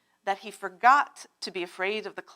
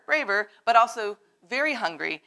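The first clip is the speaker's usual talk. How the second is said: plain reading voice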